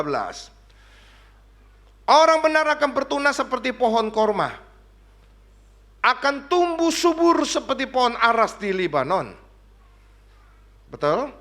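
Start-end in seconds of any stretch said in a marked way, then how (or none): 2.1-9.3 raised, loud preacher's recitation; proclaimed scripture reading. Real, not acted. Preacher reading Mazmur to the congregation, proclaimed scripture. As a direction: declaim the verse to the congregation. Reads the psalm aloud forcefully.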